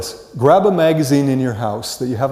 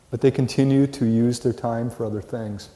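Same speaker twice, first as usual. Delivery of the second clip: ordinary talk, nothing marked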